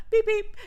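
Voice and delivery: high-pitched vocal honk; imitated car horn. Driver pushing the horn two times, high imitated beeps. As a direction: toot it out high like a little horn.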